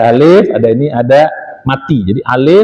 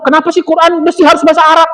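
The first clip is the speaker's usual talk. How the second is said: much higher, fast mock question; imitating a skeptic; 11 semitones higher, faster than usual. Teacher mimics people asking why Arabic, high and fast. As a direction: mimic a doubter, high and quick. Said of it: "ada orang ngomong".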